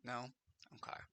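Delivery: ordinary talk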